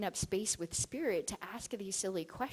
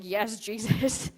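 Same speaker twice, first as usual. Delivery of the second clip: slow, weighty spirit voice, a touch louder; Mohammed answering; 3 semitones higher, 8 dB louder, much slower than usual. Mohammed confirming Jesus to her, slow solemn reply. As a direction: draw the answer out slowly like a solemn revelation.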